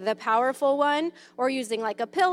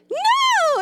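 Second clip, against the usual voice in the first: squeaky high "No", much higher and louder; really high voice; 8 dB louder than usual. Shown as a demonstration. Child answers mom's high "Yes" game, very high "No". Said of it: "in a really high voice".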